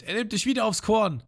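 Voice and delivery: worried Johnny. Retold or imitated. Johnny warns Ruffy he is targeted, high and alarmed. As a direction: warn that the shark is aiming again. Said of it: "Und Johnny meint".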